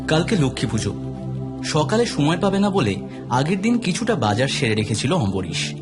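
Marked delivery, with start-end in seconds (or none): none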